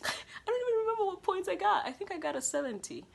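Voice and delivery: high, loud laughing disbelief. Real, not acted. Student recalling her bad paper grade, laughing.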